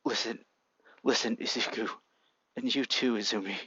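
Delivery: low, soft voice of a dying father; fading father's voice. A narrator's reading. Dying dad calling to his children, low and soft. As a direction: speak gently to your children, low and soft. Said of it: "met by a voice saying".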